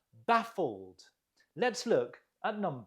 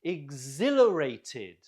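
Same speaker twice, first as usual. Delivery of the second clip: Exhilarated with ZIL stretched and louder; stress on ZIL; 2 semitones lower, 5 dB louder than usual. Shown as a demonstration. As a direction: punch the second syllable of Exhilarated. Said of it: "Note the stress on the zil".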